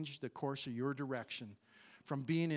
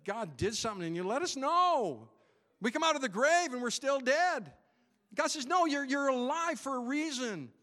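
higher, louder, stretching let us know; fervent preaching; 7 semitones higher, 5 dB louder than usual. Acted. Pastor urging the saved to show it, fervent preaching. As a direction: call it out like a revival preacher. Preaching climax, alive from grave.

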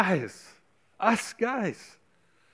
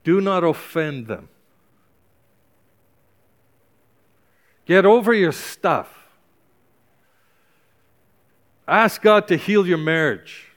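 passionate plea; 3 dB louder than usual. Real, not acted. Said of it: "trying to say this with passion in my soul".

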